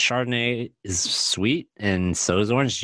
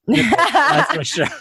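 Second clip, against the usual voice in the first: laughing; 6 dB louder, much slower than usual. Real, not acted. Laughing while denying his mistake.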